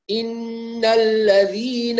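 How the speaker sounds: إن الذين recited very slowly, lam clear; slow clean recitation; model recitation. Shown as a demonstration. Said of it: "هكذا".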